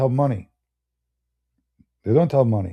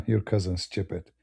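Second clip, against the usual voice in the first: acted dismissive chuckle, then softer words; mocking laugh; 3 semitones lower, 8 dB quieter than usual. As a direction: laugh it off as someone scoffing. Acting out people mocking his cousin.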